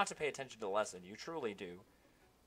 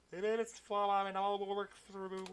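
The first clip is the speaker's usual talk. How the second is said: ghost voice again; 7 semitones higher than usual. Acted. Ghost retrying his message, high warbling wail. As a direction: quaver the words like a spirit. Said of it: "I can do a better ghost voice".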